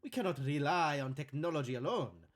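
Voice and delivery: put-on delighted host in thick Italian accent; bad Italian accent. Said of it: "faking a bad Italian accent".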